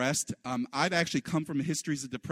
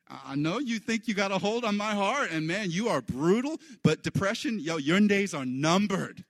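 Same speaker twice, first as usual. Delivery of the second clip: swaggering taunt; 6 semitones higher, 4 dB louder than usual. Retold or imitated. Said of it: "remind depression, say".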